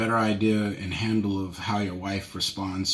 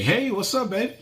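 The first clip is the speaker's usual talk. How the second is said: much higher, bright sing-song hello; cheery greeting; 13 semitones higher, 3 dB louder than usual. Retold or imitated. Husband walking in greeting his wife, cheery and much higher. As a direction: greet her like you're glad to be home.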